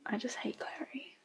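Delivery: hushed, breathy confession; whispering. Real, not acted. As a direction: whisper it like a guilty secret. Muttered confession aside.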